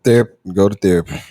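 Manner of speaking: low, condescending advice; patronizing counsel, pitched well down. Real, not acted. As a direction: talk down to them in a low voice. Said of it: "the most condescending motherfucking voice".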